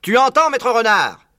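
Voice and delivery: loud, high, slow roar; bellowing. Acted. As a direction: yell it down the hole. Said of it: "vociféra Bean".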